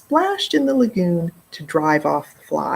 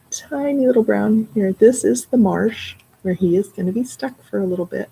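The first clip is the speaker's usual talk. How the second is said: ordinary delivery, nothing marked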